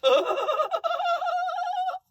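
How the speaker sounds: imitating a ridiculous high laugh; imitated ridiculous laugh. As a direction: cackle like the singer. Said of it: "And he goes".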